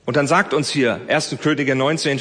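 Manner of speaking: usual speaking voice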